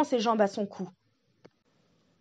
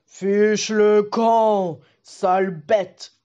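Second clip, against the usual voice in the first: slow, louder giant's bellow; shouting giant; 8 dB louder, much slower than usual. Acted. The giant shouts the wolf away, slow and loud. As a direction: roar him off the doorstep. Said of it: "crie le colosse".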